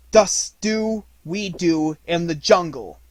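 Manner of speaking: loud and slow, each word weighed; slow loud declaration. A narrator's reading. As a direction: proclaim it over the battlefield. Mowgli's battle line.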